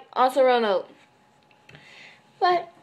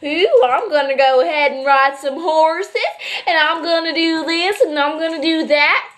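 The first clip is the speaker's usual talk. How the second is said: mock Texan accent; 2 semitones higher than usual. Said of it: "they're all like".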